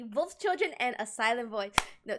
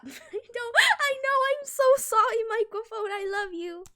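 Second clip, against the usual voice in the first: laughing; 8 semitones higher, 4 dB louder than usual. Real, not acted. Apologising to microphone.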